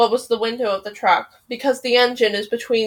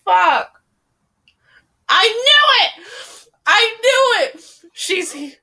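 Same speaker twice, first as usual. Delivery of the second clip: anguished wail; 10 semitones higher, much slower than usual. Real, not acted. Crying out over the book.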